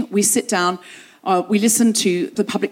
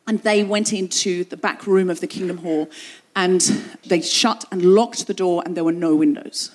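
ordinary talk

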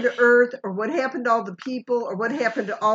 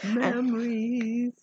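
drawn out; 4 dB quieter, much slower than usual. Pretend. Drawn out, mock wistful.

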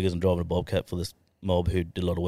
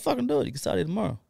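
high-pitched retold urging; 10 semitones higher, faster than usual. Boss offers a young worker a job, high-pitched urging. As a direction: urge him on in a high voice.

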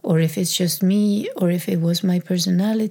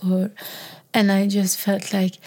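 ordinary talk, nothing marked

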